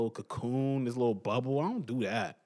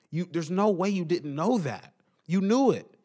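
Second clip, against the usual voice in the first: louder, higher accusation; accusing; 4 semitones higher, 4 dB louder than usual. Real, not acted. Calling out the brand that knew, louder and higher. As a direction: nail them with it.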